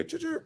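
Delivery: high, soft imitated car horn beep; mock car horn beep. Actor retelling an Idris Elba story, imitated car horn beep. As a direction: toot it like a car unlocking.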